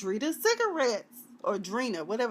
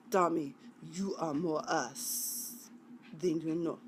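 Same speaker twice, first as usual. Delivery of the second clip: attempted Russian accent; 6 semitones lower, 7 dB quieter than usual. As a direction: put on a measured Russian accent. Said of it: "I can usually do Russian accent".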